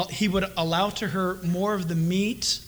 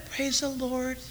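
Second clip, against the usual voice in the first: 1 sugary pious greeting; 4 semitones higher, 6 dB quieter, slower than usual. Retold or imitated. Sudden pious switch in parking lot.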